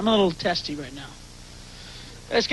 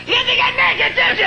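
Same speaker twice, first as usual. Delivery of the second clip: high screaming taunt, much louder; signature scream; 5 dB louder than usual. Acted. Comedian screaming at doubters who wrote him off, full scream.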